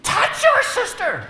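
high, loud, slow exasperated yell; idle threat. Retold or imitated. Parent in the car yelling a threat at his son, loud and slow. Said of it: "you finally say to him".

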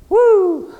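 high, loud "woo"; mock celebratory whoop. Carefree believer celebrating forgiveness, mock whoop. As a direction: whoop as if let off the hook.

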